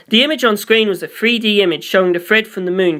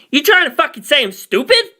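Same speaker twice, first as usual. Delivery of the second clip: higher, angry snarl with a touch of menace; 8 semitones higher, 3 dB louder than usual. A narrator's reading. Naranchi snaps at Poochie over the insult, snarling. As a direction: bristle at the insult, sharp and high. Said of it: "he snarled".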